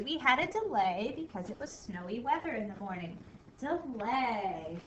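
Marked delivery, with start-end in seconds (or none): none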